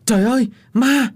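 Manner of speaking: high, startled cry of alarm; frightened scream. A narrator's reading. Two servants startled from behind shriek in fear of a ghost. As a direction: cry out in sudden fright, pitch jumping up. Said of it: "sợ hãi, thét lớn".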